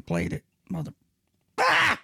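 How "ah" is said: sudden high yelp of frustration; frustrated yelp. Real, not acted. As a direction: yelp it out, exasperated. Frustrated outburst at setup.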